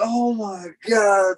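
high, slow horrified gasp of words; mock dismay. Wrestler realizing his name was skipped, slow shocked. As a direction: let it sink in slowly.

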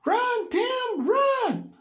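very high, loud frantic shout; panicked yell. Retold or imitated. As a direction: scream it like fleeing in terror. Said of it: "the next thing he heard was".

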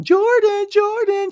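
high, loud call across a parking lot; yelling a name. Retold or imitated. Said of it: "She's yelling".

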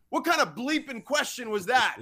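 incredulous, playfully acted telling-off; mock indignation. Coach teasing the sideline reporter, mock indignation.

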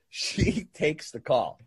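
ordinary talk

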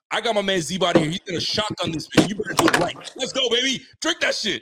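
loud, high hype for a friend; egging on a buddy shotgunning a beer; hype cheer. Real, not acted. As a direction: cheer him on as he chugs.

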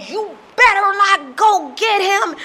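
slow, loud, high-pitched warning; imitating threatening dad. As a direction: draw out the father's threat. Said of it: "He goes".

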